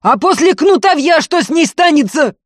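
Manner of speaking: enraged question at full voice; snapped temper, shouting; furious yell. A narrator's reading. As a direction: keep screaming, very high and loud. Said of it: "заорал, сорвавшись".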